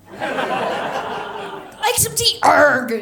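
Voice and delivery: high Cornish pirate, loud growl to end. Retold or imitated. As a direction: play a camp Cornish pirate.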